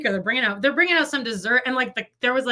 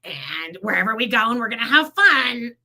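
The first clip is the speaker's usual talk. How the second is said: normal talking voice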